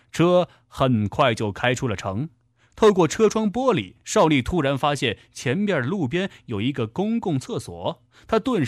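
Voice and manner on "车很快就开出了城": ordinary delivery, nothing marked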